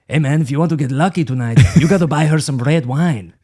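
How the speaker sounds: lightly put-on Italian accent, slick; a smooth Italian guy's tip. Italian stranger advising at the flower shelf, lightly put-on Italian accent. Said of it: "It's my Italian accent".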